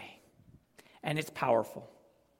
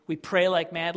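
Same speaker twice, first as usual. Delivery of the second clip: ordinary talk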